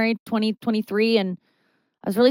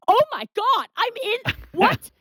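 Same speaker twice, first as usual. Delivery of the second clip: high, stunned gasp of disbelief; high-pitched retold shock; 13 semitones higher than usual. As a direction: react like you just heard your own voice on screen. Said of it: "And I'm like".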